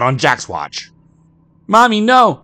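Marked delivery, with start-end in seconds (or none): 1.7-2.4 put-on loud high child's scream; crying out. Christopher crying to his mother, loud terrified scream. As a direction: scream it in terror. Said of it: "cried Christopher".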